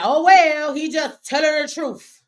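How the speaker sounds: hammed-up "oh, well" apologist voice; mock viewer quote. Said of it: "and y'all be like".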